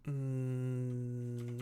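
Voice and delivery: ordinary talk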